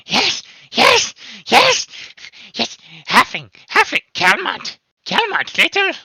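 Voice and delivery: high, loud, eager goblin squeal; excited goblin. Acted. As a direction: goblin bursting to tell. Excited goblin Helba blurting.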